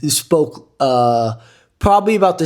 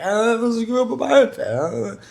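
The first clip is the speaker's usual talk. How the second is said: higher, slow mock foreign voice; imitating the French guy; 10 semitones higher than usual. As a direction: put on the French guy's voice, drawn out. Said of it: "He was like".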